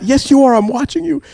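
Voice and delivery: very high, insistent contradiction; mock-outraged comeback; retold arguing. Parent insists to denying son, arguing. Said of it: "I'm arguing with him".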